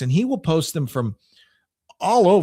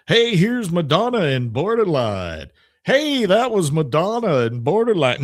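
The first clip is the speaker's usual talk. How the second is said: hammed-up radio DJ with swooping, sing-song pitch; fake DJ voice; 2 semitones higher than usual. Shown as a demonstration. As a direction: put on a cheesy DJ announcer voice. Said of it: "I'm using my fake D J voice".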